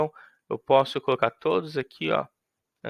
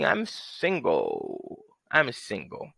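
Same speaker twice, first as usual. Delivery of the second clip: smug flirt; 5 semitones lower, 4 dB quieter, much slower than usual. Acted.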